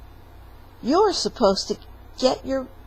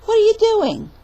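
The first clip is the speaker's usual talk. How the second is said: put-on high, loud girlish call; Cinderella calling; 13 semitones higher, 9 dB louder, faster than usual. Cinderella calling down to Jack, high and loud. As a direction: call down to him, surprised.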